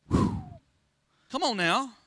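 ordinary talk, nothing marked

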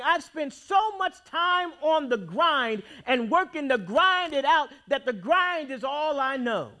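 usual speaking voice

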